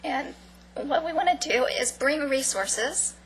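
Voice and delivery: hoarse, fading voice; losing voice. Real, not acted. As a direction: voice giving out. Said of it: "I'm losing my voice".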